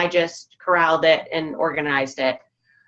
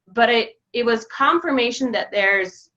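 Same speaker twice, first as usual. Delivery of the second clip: normal talking voice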